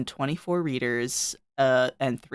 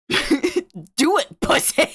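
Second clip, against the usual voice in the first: higher, giggling dare; mocking a coward while cracking up; laughing taunt; 10 semitones higher, 4 dB louder, much slower than usual.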